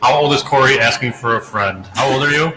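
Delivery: ordinary delivery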